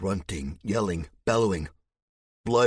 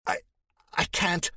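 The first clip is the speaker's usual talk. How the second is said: choked; 11 semitones higher than usual. Acted. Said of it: "the words choked".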